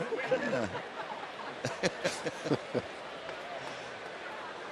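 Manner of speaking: soft, drawn-out laugh; real chuckling. Real, not acted. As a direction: chuckle quietly at the joke. Laughing at the joke.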